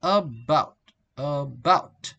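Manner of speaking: "about" split, light "a", long stressed "bout"; weak first syllable. Shown as a demonstration. Teacher drilling weak syllables, "about" with a reduced first syllable. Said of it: "Weak syllable".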